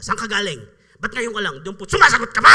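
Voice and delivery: loud scolding questions, rising in fury; mother scolding. Retold or imitated. Strict Waray mother scolding her late child, loud.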